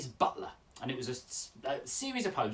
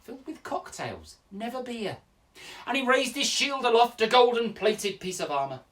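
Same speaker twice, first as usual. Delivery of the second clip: loud, theatrical tale-telling with big swings in pitch; story poem recital; 6 semitones higher, 9 dB louder than usual. Acted. Telling children the tale of Sir Fabulous, grand storytelling.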